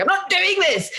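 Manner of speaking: high, mock-petulant refusal; hammed-up diva storming off; diva tantrum. Retelling storming off the shoot.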